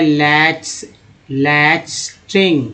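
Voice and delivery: लैस्टिंग drawn out very slowly, piece by piece; very slow sounding out. Shown as a demonstration. Teacher to students, very slow word demo. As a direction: stretch लैस्टिंग out slowly for learners. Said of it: "teacher sounds out word".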